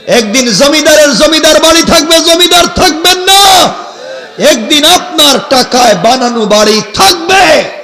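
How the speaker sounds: high, loud warning that rises to a shout; impassioned preaching shout. Real, not acted. Preacher warning that owners will be gone, impassioned shout. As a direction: thunder the warning out to the crowd. Sermon builds on death warning.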